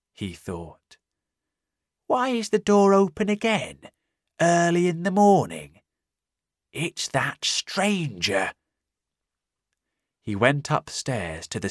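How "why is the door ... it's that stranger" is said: higher puzzled man's voice, slowing down; suspicious innkeeper. Acted.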